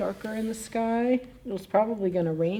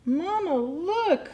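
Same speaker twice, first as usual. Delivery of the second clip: put-on baby bear calling; 11 semitones higher, much slower than usual. Baby bear calls out in story.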